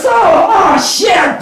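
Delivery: high, loud yelp of alarm; hammed-up shout; shouted curse. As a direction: blurt it out in shock. Outburst after building repetition.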